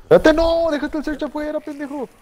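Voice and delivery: high-pitched exasperated outburst; exasperated yell. Real, not acted. Regaña al compañero en partida. (estallido exasperado)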